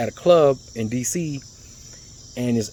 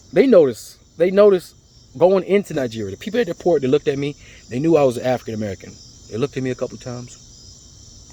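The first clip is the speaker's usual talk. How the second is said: ordinary delivery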